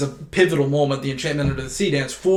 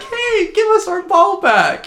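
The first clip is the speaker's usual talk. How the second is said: high, drawn-out kiddie voice; pleading kids; 4 dB louder, slower than usual. Retold or imitated. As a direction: imitate little kids calling for their ball. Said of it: "They're like".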